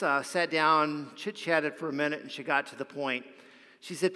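ordinary delivery

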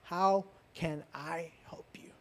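breathy whisper, slow and soft; hushed whisper of a gentle God; whispered offer of help. Acted. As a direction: whisper it like God speaking close to your ear. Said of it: "is what God is telling you today".

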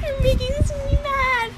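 giggling high through the words; laughing tease. Real, not acted. Friend teasing her friend, laughing high.

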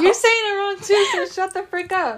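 playfully fed-up outburst; mock indignant retort. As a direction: tell them off with hammed-up outrage. Acted retort to wrong corrector.

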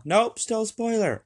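high, loud warning yell; demonstration yell. Shown as a demonstration. Podcaster warning listeners spoilers are still on, yelled. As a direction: yell it out as a sudden heads-up. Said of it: "I'll yell".

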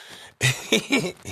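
high tittering giggle. Real, not acted. Man retelling the British battle joke, high giggle. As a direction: titter at a naughty double meaning. Laughs at the teacher's wording.

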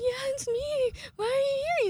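very high, loud squeal; mock starstruck. Fan answering the singer, squealing very high.

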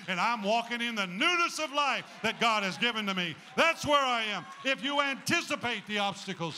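loud, rising preacher's climb; full-force preaching. Acted. Preacher declares the new season, loud rising preaching. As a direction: build to a shouting crescendo.

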